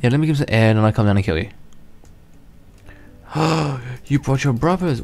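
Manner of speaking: normal talking voice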